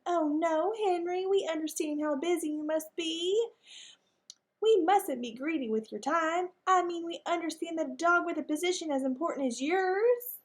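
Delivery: high, sugary, pointed lady dog voice; sweetly scolding like a proper lady; prim lady dog. Acted. Mag flattering, eager to see him go.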